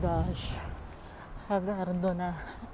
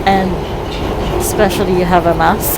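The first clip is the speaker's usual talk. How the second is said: loud; 3 semitones lower, 9 dB louder than usual. Real, not acted. Hot crowded train, mask on.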